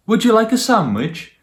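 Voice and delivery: hospitable mummy. Acted. Mummy offering the tiger a sandwich, high sweet hostess voice. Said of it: "Sophie's mummy said".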